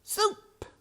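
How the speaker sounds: very high rat cry; a rat shouting a treat; called out. A narrator's reading. Another rat calls out, very high. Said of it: "called out another rat".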